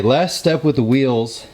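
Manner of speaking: ordinary talk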